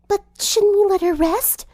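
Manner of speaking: shy driver defending herself; lightly voiced gentle girl; timid Fluttershy. A narrator's reading. Timid protest.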